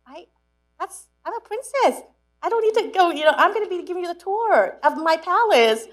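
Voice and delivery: high, loud, haughty princess voice; mock grand princess. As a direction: lord it over him like royalty, playfully. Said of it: "I'm like".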